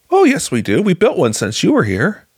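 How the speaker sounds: high, emphatic imitated correction; mock keen insistence. Director tells former worker of new chapel, high insistent voice. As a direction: insist proudly, pitched well up.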